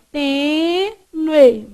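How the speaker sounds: "Tênue" as tê, nue, first syllable held long; split into syllables. Shown as a demonstration. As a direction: separate "Tênue" into two drawn-out syllables. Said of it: "eu já separei certo".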